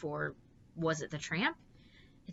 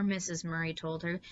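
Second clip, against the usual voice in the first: plain reading voice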